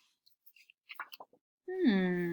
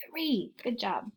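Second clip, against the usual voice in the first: normal talking voice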